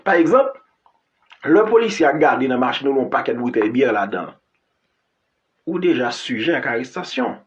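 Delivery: ordinary talk, nothing marked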